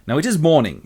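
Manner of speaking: plain reading voice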